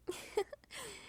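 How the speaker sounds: a short, amused little laugh; soft chuckle. Acted. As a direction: chuckle at your own awkward joke. Vampire chuckling at own joke.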